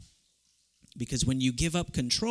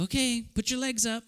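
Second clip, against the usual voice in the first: mother's voice; 7 semitones higher than usual. Retold or imitated. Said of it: "And then she'd go".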